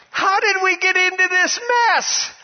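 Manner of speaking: very high, cried-out question; impassioned preacher's cry; full-force preaching. Real, not acted. Preacher cries out his question.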